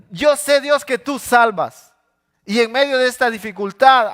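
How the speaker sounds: fervent proclamation. Acted. David declaring trust in God amid trouble, high loud cry. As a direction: shout out the faith. David clings to God's truth.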